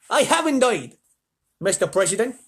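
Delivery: high, loud eager manager; hotel manager's put-on voice. A narrator's reading. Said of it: "What voice should I do for him?".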